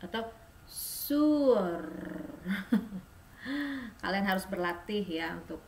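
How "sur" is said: "sur" with throaty French r; French r demo; uvular r. Shown as a demonstration. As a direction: gargle the r lightly. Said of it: "bilang aja sur atau".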